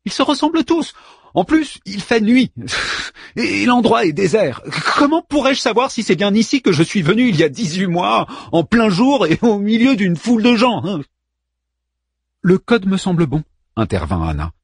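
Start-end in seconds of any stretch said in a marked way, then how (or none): 0.1-11.0 put-on whiny young man. Daeman protesting in high voice. 12.4-13.4 light woman's voice. Acted. Anna voiced higher.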